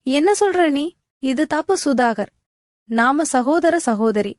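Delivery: plain reading voice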